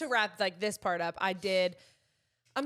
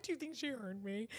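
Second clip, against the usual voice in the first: quiet worry; 10 dB quieter than usual. Retold or imitated. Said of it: "I'm like".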